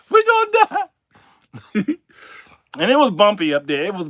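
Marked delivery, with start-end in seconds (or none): 0.1-0.9 very high, much louder scream; screaming panic. Retold or imitated. Passenger sure of the crash, very high screaming.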